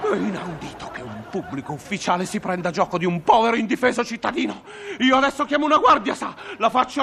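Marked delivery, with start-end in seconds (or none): none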